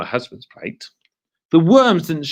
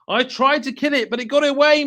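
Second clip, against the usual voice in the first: mock scary husband; 11 semitones higher, faster than usual. Mr Twit voiced.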